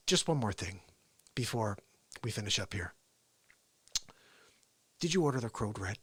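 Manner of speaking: low, soft, measured courtroom questioning; hushed intensity with pauses; Tom Cruise. Acted. Lawyer cornering a colonel, quiet controlled impression. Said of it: "That's kinda how he sounds".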